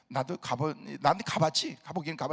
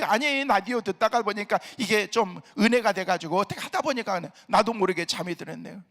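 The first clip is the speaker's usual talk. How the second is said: normal talking voice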